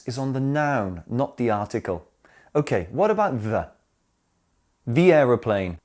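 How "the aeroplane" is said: "The" said "thee" before "aeroplane"; "thee" before vowel. Shown as a demonstration. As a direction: say "The" with a long ee, linking into "aeroplane". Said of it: "notice the difference in pronunciation".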